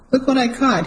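calling up from the dock; called out. A narrator's reading. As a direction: call it out to the terrace. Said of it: "he called out".

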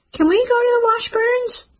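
small son, high and loud, begging his mom; eager little boy. A narrator's reading. Little Jasper asks his mom.

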